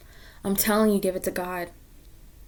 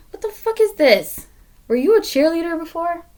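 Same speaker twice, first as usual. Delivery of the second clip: much higher, loud outraged squeal; squeaky disbelief; 8 semitones higher, 4 dB louder than usual. Real, not acted. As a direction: shriek it in shocked disbelief. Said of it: "I don't talk like this".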